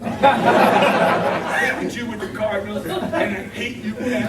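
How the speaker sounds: loud, slow, laughing jab; laughing insult. Retold or imitated. Telling Conrad off on the plane, laughing loudly. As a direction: spit the insult out through laughter.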